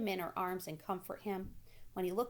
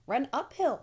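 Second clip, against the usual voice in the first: loud, higher sheep call; 7 semitones higher, 8 dB louder than usual. A narrator's reading. Sheep calling advice to Wilbur, loud. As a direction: bleat the advice loudly. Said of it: "said the sheep".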